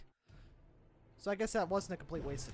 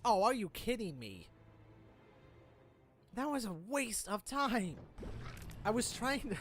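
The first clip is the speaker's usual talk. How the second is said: exasperated; 6 semitones higher, 3 dB louder, slower than usual. Real, not acted. Gamer frustrated by wasted move.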